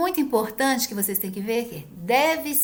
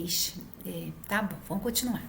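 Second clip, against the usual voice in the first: soft whispered ixi, giving up the point; whispered aside; 4 semitones lower, 8 dB quieter than usual. Retold or imitated. Homeopath backing off from nervous patient, whispering. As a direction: breathe it out, let it go.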